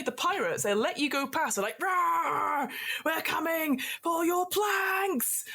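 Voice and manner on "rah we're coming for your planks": high, slow pirate roar; pirate shout. Acted. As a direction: bellow it like a rowdy pirate.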